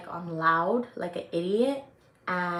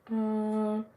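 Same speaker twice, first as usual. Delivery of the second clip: normal talking voice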